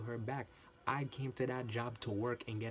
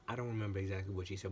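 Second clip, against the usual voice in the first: usual speaking voice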